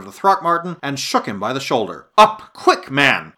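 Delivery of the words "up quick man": urgent cry. A narrator's reading. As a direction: cry out to rouse a sleeper. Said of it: "I cried".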